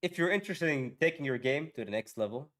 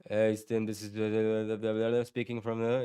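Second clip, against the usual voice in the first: low, monotone mumbled sales call; bad cold caller; 5 semitones lower than usual. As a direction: imitate a worker droning through a script. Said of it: "poor workers ... do a really shit job".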